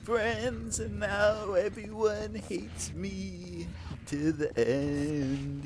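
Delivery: mock sad lament. Dramatic lament, slowed.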